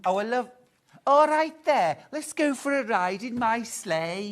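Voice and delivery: put-on a cheery reindeer in loud scouse, Liverpool accent. Voicing a reindeer toy for the co-host, loud Liverpool accent. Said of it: "I prefer your Liverpool".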